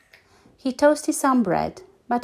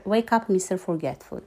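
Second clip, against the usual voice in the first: plain reading voice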